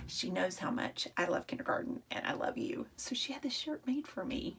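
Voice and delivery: soft confiding whisper. Real, not acted. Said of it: "whispering to the class".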